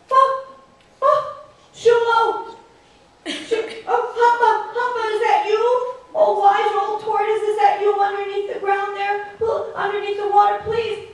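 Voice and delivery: high, loud, breathless begging; panicked pleading. Acted. Shulo held underwater, begging the tortoise to let go. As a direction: beg for your life, half laughing. Caught trickster begs tortoise.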